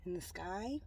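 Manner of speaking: ordinary talk, nothing marked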